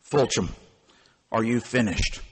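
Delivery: a hint of a weary old auditor, low and quiet; Sigmund Wohlman. Night auditor cutting off the ranting young clerk, low and quiet. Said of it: "Sigmund Wohlman, the night auditor".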